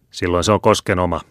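usual speaking voice

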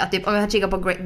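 normal talking voice

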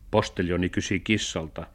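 ordinary delivery, nothing marked

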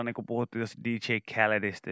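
ordinary delivery

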